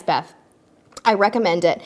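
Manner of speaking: ordinary talk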